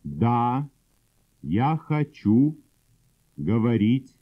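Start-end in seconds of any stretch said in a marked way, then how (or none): none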